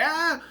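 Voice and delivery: short, high mock scream; imitated frightened squeal. Imitates her reaction to crocodile.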